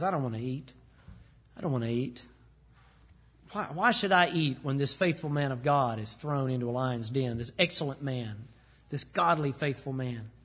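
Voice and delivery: a grieving king pushing food away; despondent. Retold or imitated. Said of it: "He's depressed".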